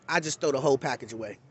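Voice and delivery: normal talking voice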